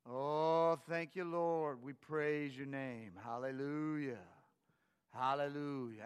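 mild imitation of a grandfather's lilting praise, drawn out; lightly voiced old preacher murmuring thanks to God; sing-songy praising old pastor. Grandson imitating his grandfather praising in the kitchen, lightly sing-song. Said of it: "it was, like, sing-songy".

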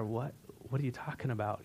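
hushed, low-pitched stunned question; stunned disbelief. Retold or imitated. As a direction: imitate someone too amazed to speak up. Acting stunned employees hearing the gift.

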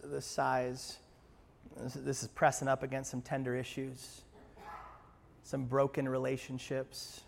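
pastor leading the church in prayer, hushed. Acted. Leads prayer.

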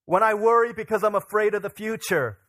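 loud, high, rallying sermon delivery; impassioned preaching. Real, not acted. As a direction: proclaim it to the congregation, building in force. Preaches at full force.

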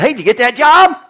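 mom asking. Retold or imitated.

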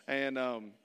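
normal talking voice

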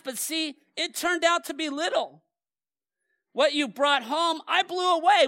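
loud, high prophetic proclamation; preaching at full force. Acted. Pastor to congregation, preaching the verses at full force. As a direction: thunder the scripture at the pews.

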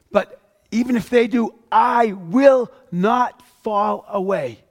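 slow, loud, stubborn promise; boastful vow. Acted. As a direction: Peter digging in, swearing he'll stand firm. Preacher voicing Peter's boast.

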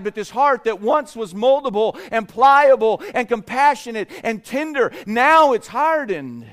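sermon climbing in force; rising preaching. Acted. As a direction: press the lesson home from the pulpit. Preacher drives home the moral.

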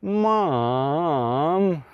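put-on drawn-out childish whine of Mom; whiny Mom. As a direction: whine it like a sulking kid. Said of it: "I don't know that Jesus ever whined".

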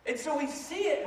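ordinary talk, nothing marked

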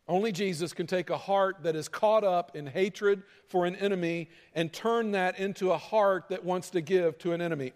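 ordinary talk

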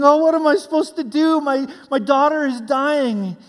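loud, high cry of a pleading father; screaming desperate dad. Retold or imitated. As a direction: act out a frantic father begging for help. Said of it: "the dad that came screaming".

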